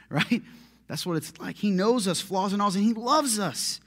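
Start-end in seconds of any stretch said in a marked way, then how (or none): none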